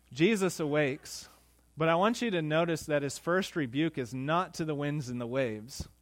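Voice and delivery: normal talking voice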